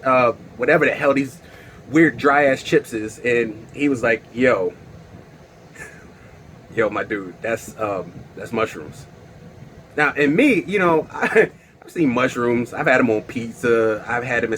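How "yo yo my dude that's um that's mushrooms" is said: quiet. Retold or imitated. Friend quietly breaks the news.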